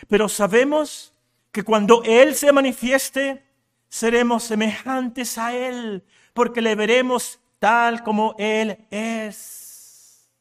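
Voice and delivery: slow, swelling preacher's delivery; emphatic scripture reading. Real, not acted. Preacher reads the promise to the congregation, emphatic preaching. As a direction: proclaim the verse, drawing out the last words.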